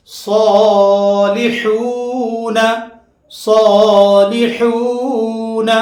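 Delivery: صالحون with the long vowel stretched out slowly; long madd vowel. Shown as a demonstration. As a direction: draw out صالحون like a Quran teacher. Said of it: "اسی طرح وہ مدہ کی مثال". (صالحون کو لمبا کھینچ کر پڑھیں)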